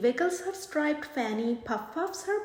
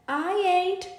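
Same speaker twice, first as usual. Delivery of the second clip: smirking; 5 semitones higher, 5 dB louder than usual. Acted. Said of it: "smirks Mademoiselle Possum".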